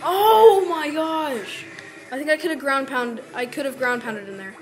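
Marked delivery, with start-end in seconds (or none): 0.0-1.6 loud, very slow drawn-out cry; long loud wail of dismay; anguished cry. Real, not acted. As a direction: stretch the oh in agony. Close call in the fight.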